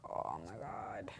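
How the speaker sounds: slow whispered horror at being caught; dreading whisper. Retold or imitated. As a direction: breathe it out slowly, mock dread.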